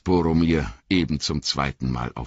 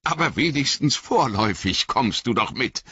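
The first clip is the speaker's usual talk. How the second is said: higher-pitched insisting plea; insistent; 8 semitones higher than usual. A narrator's reading. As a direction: press the point, refusing to let go. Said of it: "beharrte Boromir".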